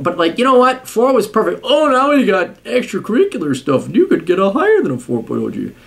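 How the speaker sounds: high-pitched incredulous griping; mocking exasperation. Real, not acted. Mocks grade inflation in rant.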